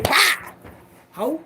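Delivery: a sudden high bark; sharp outburst. Retold or imitated. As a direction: cut him off hard.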